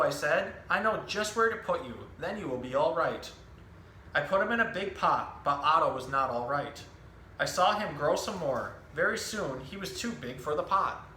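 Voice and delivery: lively picture-book storytelling voice, each line clearly paced; reading to children. Acted. Zookeeper reading a book to children, animated storytelling. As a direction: tell the story to a room of young kids.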